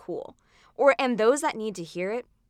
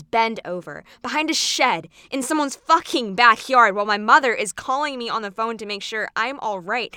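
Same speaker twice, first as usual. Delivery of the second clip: forceful loud quote; 3 semitones higher, 7 dB louder than usual. Acted. Performer voicing a coercive boy.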